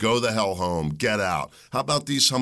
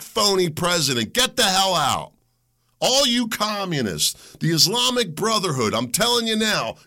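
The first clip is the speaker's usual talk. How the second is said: ordinary delivery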